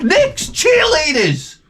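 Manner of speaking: high-pitched holler; excited cheer. Acted. Fan celebrates the New York cheerleaders, high excited shout.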